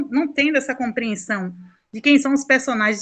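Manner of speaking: usual speaking voice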